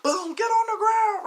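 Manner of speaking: very high, loud, drawn-out mock shout; shouted police command. Police slam the man down, high loud shout. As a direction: yell the slam and order high and stretched.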